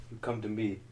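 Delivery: low, hushed Don Corleone rasp; Godfather impression. Brother asks about godfather, answered as the Don. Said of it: "about being the Godfather".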